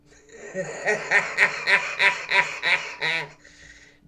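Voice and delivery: sudden loud laugh; loud high laugh. Real, not acted. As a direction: burst out laughing. Laughs while talking about dad.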